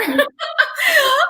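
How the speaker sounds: high, shrieking burst of laughter; real delighted cackle; real loud laugh. Real, not acted. Host laughs at the story.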